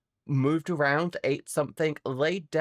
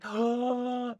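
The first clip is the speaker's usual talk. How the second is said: a high startled gasp; sudden shocked intake of breath; sharp gasp; 9 semitones higher than usual. Real, not acted.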